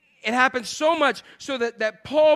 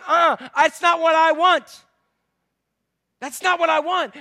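protesting believer; 5 semitones higher, 4 dB louder than usual. Acted. Believer objects to God's answer, mock complaining. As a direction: push back like a thwarted child. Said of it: "And you say".

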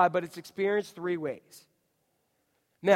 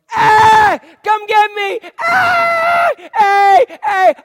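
much higher and louder wailing cries; hammed-up infant wail in the night; baby screaming; 13 semitones higher, 14 dB louder, much slower than usual. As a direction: howl like a baby who wants to be picked up. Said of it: "And I start going".

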